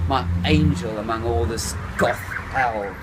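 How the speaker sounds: plain reading voice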